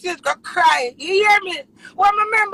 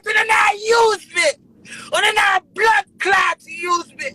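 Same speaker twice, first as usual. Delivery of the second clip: tearful shout; 5 semitones higher, slower than usual. Real, not acted. Tearful rant about being used.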